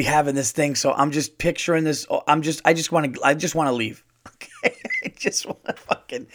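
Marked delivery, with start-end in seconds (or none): 4.3-6.3 breaking up mid-sentence, high giggle; real laughter squeezing out the words; laughing. Real, not acted. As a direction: try to talk through a laughing fit. Cracks up retelling his discomfort.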